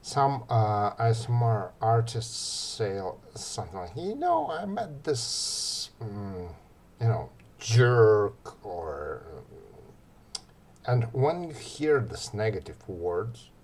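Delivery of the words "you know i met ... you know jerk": high-pitched chatty complaint that lands on a loud "jerk"; mock a careless ASMR artist griping; chatty complaint, voice jumping much higher, "jerk" punched loud; bad ASMR example. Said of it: "let's give you a bad example".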